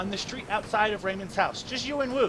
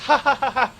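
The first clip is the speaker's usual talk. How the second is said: desperate laugh; 8 semitones higher, 10 dB louder than usual. Acted. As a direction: laugh out fear and frustration. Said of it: "Then he begins to laugh".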